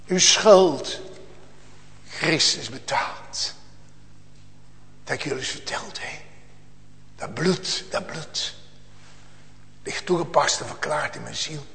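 very soft, low, with long pauses; hushed dying testimony. Acted. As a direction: confide it quietly from the deathbed. Dying mother's testimony to her child. (heel zacht en gedragen, als stervende moeder)

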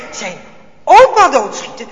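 plain reading voice

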